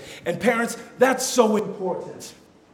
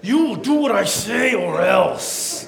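slow, menacing put-on mother voice; angry mom; 3 semitones higher, 3 dB louder, slower than usual. Mom threatening her children, slow mock-angry warning. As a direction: threaten the kids, word by word. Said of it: "She was angry everywhere all the time".

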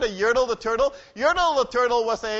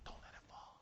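whisper; 32 dB quieter than usual. Real, not acted. Whispered aside mid story.

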